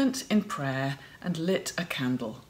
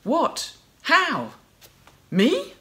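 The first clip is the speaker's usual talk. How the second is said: high, rising astonished questions; Mary reacting to the angel, with mild astonishment; 8 semitones higher, 4 dB louder, much slower than usual. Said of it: "She responds".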